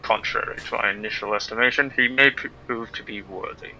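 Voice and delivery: soft, low, condescending villain; silky polite villain. Acted. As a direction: purr it like a gracious tyrant. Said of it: "Gotta do it in villain voice".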